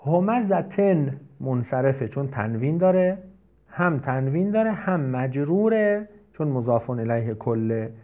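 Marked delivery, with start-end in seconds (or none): none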